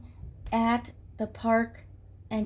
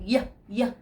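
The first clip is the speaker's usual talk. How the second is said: mock disgust; slower than usual. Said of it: "didn't like that color".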